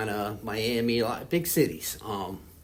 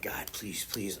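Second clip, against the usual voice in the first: softer, pleading foxhole prayer; softly retold prayer; 5 semitones lower, 11 dB quieter, slower than usual. Injured man on a helicopter begging God, soft plea. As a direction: mumble a desperate plea to God. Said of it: "I'm saying those foxhole prayers".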